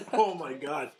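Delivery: slow, laughing, choking on water; coughing up water. Real, not acted. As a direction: gasp it out after swallowing wrong. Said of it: "I am choking on water here".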